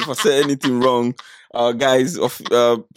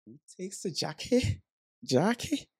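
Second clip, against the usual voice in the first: softer, hesitant Mancunian try; quiet Manchester attempt; 5 semitones higher, 11 dB quieter than usual. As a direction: mumble the Manchester line, unsure. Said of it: "go on, do it again".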